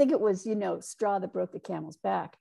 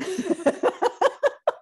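high breathless burst of laughter; 12 semitones higher, 6 dB louder than usual. Real, not acted. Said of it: "I have to catch my breath again".

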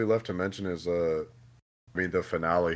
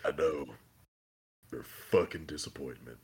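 low, soft mock Soldier Boy sneer, repeated; lightly voiced cold put-down, lower and softer; Soldier Boy quote; 10 semitones lower, 11 dB quieter than usual. Quoting Soldier Boy to Homelander again, low soft sneer. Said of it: "retold quote again".

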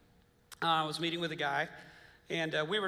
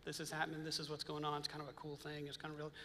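softer, offhand heads-up; understated, matter-of-fact re-enactment; low-key telling; 8 dB quieter than usual. Retold or imitated. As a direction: pass the news along casually and quietly. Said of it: "kinda telling it kinda like I'm saying it".

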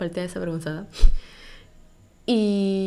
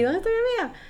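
much higher, searching for a friend; anxious high call; 14 semitones higher than usual. Retold or imitated. Leaving the club, calling anxiously for her friend. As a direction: call it out, looking around worried. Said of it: "Sí".

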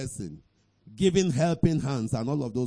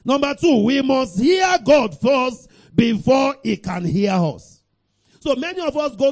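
full-force preaching; 8 semitones higher, 9 dB louder than usual. Real, not acted. Preacher announcing the second point to worshippers, forceful preaching. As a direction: drive the point out to the whole church.

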